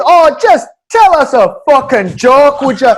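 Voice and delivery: loud, slow, high heckle; an angry woman heckling the stage; heckler yelling. Retold or imitated. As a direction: shout down the comic from the crowd. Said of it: "she goes".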